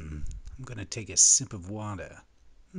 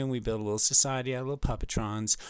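ordinary talk, nothing marked

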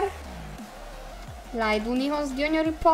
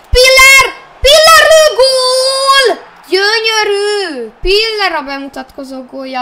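excited Hungarian sports commentary; 5 semitones higher, 10 dB louder than usual. Pretend. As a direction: call the goal like a stadium commentator. Goal build-up commentary.

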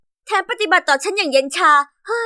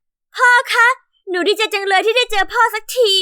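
crying with joy; 6 semitones higher than usual. Acted. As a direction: burst into happy tears hugging dad. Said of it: "ฉันร้องไห้อย่างดีใจ".

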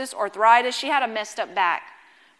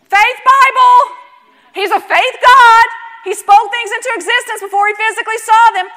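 rising, loud, fervent preaching; preaching at full force; 6 semitones higher, 9 dB louder than usual. Real, not acted. Preacher stirring up the congregation, loud and fervent. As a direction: preach it hard, building to a shout.

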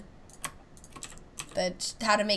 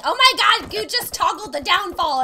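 mock outraged viewer; 8 semitones higher, 7 dB louder than usual. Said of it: "someone being like".

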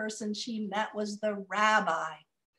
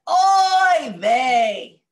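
loud grumpy groan of oy vey; grumpy old woman; 5 semitones higher, 14 dB louder than usual. Acted. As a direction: wail it like a grouchy old woman. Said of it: "I get to be very grumpy".

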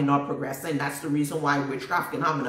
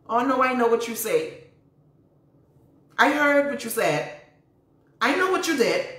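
higher, building to a high knowing cry; knowing retort; 7 semitones higher than usual. Retold or imitated. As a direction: let them know you saw it all.

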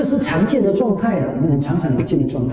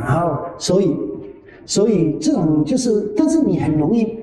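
ordinary talk

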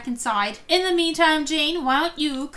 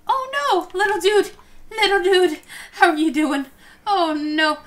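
cooing at a pet; 5 semitones higher, 3 dB louder than usual. Real, not acted. Cooing at sad pet dog.

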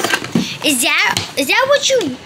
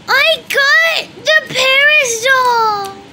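excited shout; 5 semitones higher, much slower than usual. Real, not acted. Child cheers at the toy she unwrapped, excited shout. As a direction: announce the prize at the top of your voice.

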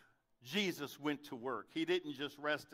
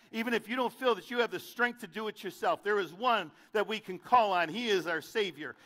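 louder, higher closing appeal; preaching at full force; 7 semitones higher, 8 dB louder than usual. Acted. Preacher urging listeners to faith, rising loud appeal. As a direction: build the altar call.

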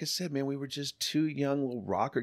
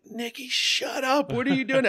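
high, loud, alarmed cry of disbelief; alarmed shout; 7 dB louder than usual. Retold or imitated. Friend panics at his shootout plan.

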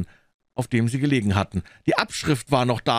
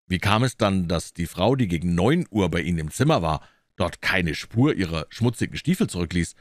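low-pitched, measured detective voice; probing detective; 7 semitones lower than usual. A narrator's reading. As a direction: probe the account in a low register. Holmes questioning Phelps. (tiefe, prüfende Detektivstimme)

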